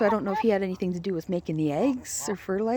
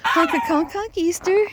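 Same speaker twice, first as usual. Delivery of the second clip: higher mock honk, rising at the end; high sing-song goose call; 8 semitones higher, 4 dB louder than usual. Man greets his goose friend, high rising mock honk.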